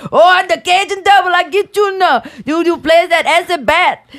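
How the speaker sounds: high Cajun devil impression. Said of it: "the fucking Cajun Devil".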